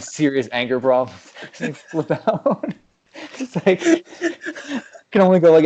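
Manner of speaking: ordinary talk, nothing marked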